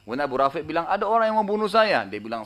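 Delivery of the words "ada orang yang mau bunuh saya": higher, louder cry for help; panicked cry. Retold or imitated. Wounded Abu Rafi calling for help, panicked. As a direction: cry out that someone wants to kill you.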